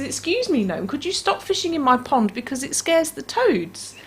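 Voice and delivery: high, polite little witch voice; a polite witch asking nicely; politely. Acted. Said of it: "she very politely says".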